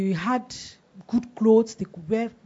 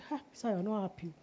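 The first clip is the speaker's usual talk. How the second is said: much softer, quick aside; discouraging inner voice; 3 semitones lower, 13 dB quieter, faster than usual. Retold or imitated. The thought nagging the preacher, quietly discouraging.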